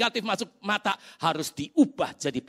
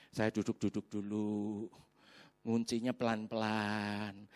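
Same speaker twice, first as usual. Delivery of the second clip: slow, low, drawn-out stalling; dragging, soft-spoken procrastination; deliberate stalling; 9 semitones lower, 9 dB quieter, slower than usual. Retold or imitated. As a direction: stretch each small action out to delay. Nguncinya pelan-pelan.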